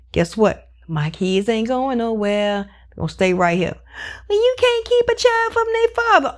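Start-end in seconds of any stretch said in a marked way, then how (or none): 4.3-6.4 high, put-on whine of the other parent; mocking whiny voice. Mother mocking the father's side in a custody argument, high whiny mimicry.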